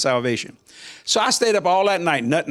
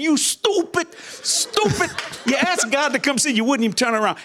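higher, laughing rebuke of himself; mock self-scolding; 6 semitones higher than usual. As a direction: scold yourself through laughter. Scolding himself after missing God.

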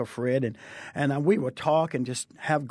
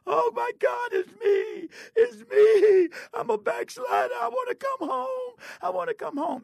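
much higher, crying out through sobs; weeping and crying. Retold or imitated. Young girl runs to the altar weeping, crying out. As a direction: plead through tears, voice breaking high. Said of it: "jumped up weeping and crying".

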